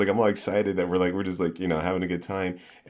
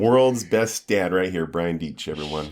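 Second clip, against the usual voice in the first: slow, loud mock introduction tapering off; self-mocking fanfare, dropping to usual level; ironic announcer; 5 dB louder than usual. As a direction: present yourself sarcastically to the crowd. Ironically presents himself as best dad.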